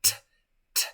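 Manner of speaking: a bare, voiceless puff of T; T sound. Shown as a demonstration. Teacher reminding students how T sounds, bare puffed T. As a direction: say only the T sound, "t", with no vowel. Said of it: "La T suena t".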